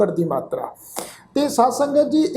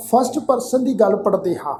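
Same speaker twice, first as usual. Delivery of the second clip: ordinary talk, nothing marked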